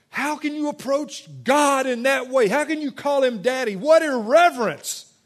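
hammed-up outrage at irreverence, slowing at the end; indignant friend. Friend scolds him for calling God daddy, indignant and loud. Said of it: "He came up to me".

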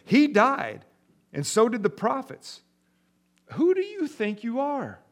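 high, sneering challenge; high incredulous jeer; mocking scorn. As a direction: scoff at his claim.

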